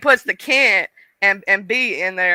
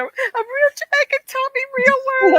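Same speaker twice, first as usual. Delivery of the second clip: excited gush. Retold or imitated. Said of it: "And I was like".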